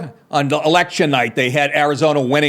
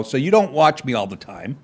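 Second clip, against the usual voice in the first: ordinary talk, nothing marked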